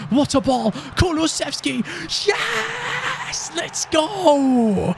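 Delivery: high shouting yes and let's go; screaming celebration; goal celebration. Real, not acted. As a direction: explode with joy at the goal.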